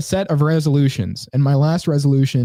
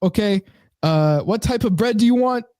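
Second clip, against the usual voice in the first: put-on higher-pitched, shaky grasp of Caribbean accent; faulty Caribbean accent; 8 semitones higher than usual. Deli worker asks about bread, faulty Caribbean accent. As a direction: attempt a Caribbean accent and miss. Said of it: "didn't even sound right".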